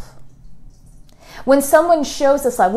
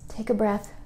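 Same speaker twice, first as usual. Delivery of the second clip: soft, hushed affirmations, drifting into whispers; guided meditation; 3 semitones lower, 11 dB quieter than usual. Acted. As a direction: lead them gently into self-love, half whispering.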